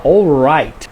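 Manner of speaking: "all right" drawn out with a stressed English R; English R sound. Shown as a demonstration. Showing learners the R sound, exaggerated English R. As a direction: say "all right" slowly, leaning on the R. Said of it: "é o som do R".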